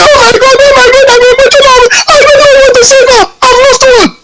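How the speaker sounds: high, breathless gushing fan voice; gushing woman fan. Retold or imitated. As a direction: squeal it like an overwhelmed fan. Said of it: "a woman will come say".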